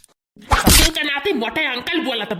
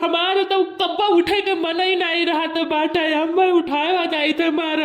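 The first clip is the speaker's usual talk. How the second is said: whiny, much louder blubbering; mock crying; 3 dB louder than usual. Kid blubbers his wake-up excuse to the teacher, mock crying. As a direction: blubber your answer to the teacher. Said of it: "रोवे के लिए नहीं बोले भाई".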